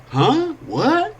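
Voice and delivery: high surprised huh; stunned. Acted. Man on bench stunned by the invitation, blurting high. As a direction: blurt it out in disbelief.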